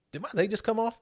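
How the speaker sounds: high-pitched, offhand imitation of a casual question; mock nonchalant. Podcaster quoting the movie's legless man to his co-host, nonchalant. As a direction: toss it off in a high, unconcerned voice. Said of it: "Just all nonchalant".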